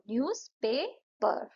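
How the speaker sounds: न्यूज़पेपर said slowly, न्यूज़-पेपर; syllable beats. Shown as a demonstration. Teacher counts the beats in न्यूज़पेपर. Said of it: "न्यूज़पेपर में दो".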